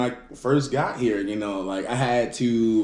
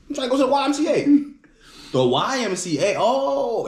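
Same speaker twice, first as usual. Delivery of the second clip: uncle's voice; 11 semitones higher than usual. Retold or imitated. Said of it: "He was like".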